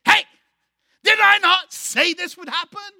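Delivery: fighting mad. Acted. Grieving mother screaming at Elisha for raising her hopes, furious shout. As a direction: hurl every question at him like a blow. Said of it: "now is fighting".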